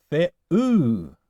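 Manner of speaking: "the-oo" with the weight on the long "oo"; stress on last syllable. Shown as a demonstration. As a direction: land firmly on the "oo" of "the-oo". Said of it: "still goes on the last syllable".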